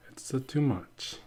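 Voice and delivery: whispered aside, slow; whispering. Pretend. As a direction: breathe it out as a hushed whisper. Acting out own inner thought.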